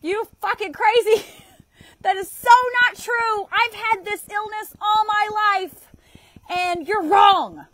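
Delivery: loud, high, slowing on "and you're wrong"; mock angry outburst. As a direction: yell it back like you're fed up. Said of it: "You could be like".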